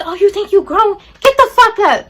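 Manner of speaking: high, loud scolding yell; retold mother's yelling. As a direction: imitate an angry mom shouting. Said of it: "just started yelling at me".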